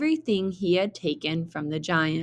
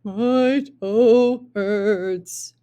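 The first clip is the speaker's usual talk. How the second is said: very slow, louder hurt moan; whimpering giant; 4 semitones higher, 6 dB louder, much slower than usual. Acted. Fallen giant whimpers about his toe, slow and drawn out. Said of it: "whimpered the giant".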